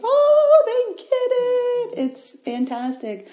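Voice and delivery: high, slow, overcome squeal of joy; teary joy. Retold or imitated. Retelling her reaction to listeners' progress, a high teary gush. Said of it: "and I'm like".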